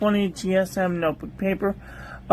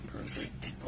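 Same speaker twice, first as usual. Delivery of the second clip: whispered verdict; 19 dB quieter than usual. Real, not acted. Whispered verdict on ink test.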